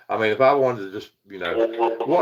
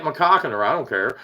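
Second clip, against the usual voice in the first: usual speaking voice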